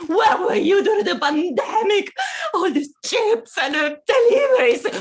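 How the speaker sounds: high, loud hammed-up wail; overdone tearful shout; mock crying shout. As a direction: sob out the complaint. Wailing at absent junk food.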